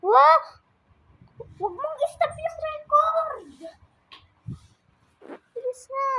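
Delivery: high, urgent, near tears; panicked plea. Real, not acted. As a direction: plead frantically, almost crying. Begs brother not to stop.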